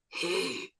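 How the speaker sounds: lightly voiced old man, slow and coaxing; old man beckoning. Kid calling Chuck over, a light old man voice. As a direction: call him over like a creepy grandpa. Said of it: "a twelve-year-old going".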